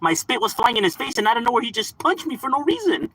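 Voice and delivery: lightly voiced innocent customer; mock playing victim. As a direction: imitate someone playing the victim. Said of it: "then they play the victim after".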